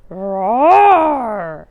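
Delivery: bear roar. Acted. Old Bear roaring at the oven. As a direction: roar like a bear smelling food.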